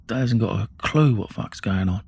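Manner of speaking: plain reading voice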